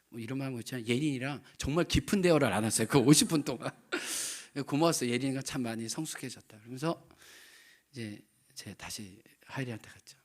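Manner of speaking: ordinary talk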